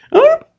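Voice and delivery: very high, loud dismayed "ah"; exaggerated childish groan; high comic whine. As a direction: whimper like a caught kid. Boy's dismayed sigh.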